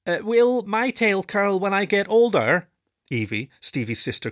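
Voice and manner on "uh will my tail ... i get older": put-on high anxious young voice; worried pup. A narrator's reading. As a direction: ask it fretting. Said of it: "cried out".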